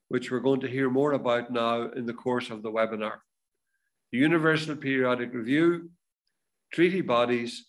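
normal talking voice

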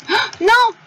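high, sudden "Oh, non!" of dismay; dismayed cry. Real, not acted. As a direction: react as the game dies on you. Game just crashed.

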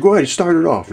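high, loud nudge to start the joke; hearty push to kick off the joke; loud urging. Retold or imitated. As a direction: egg him on out loud. Urging a guy at the table.

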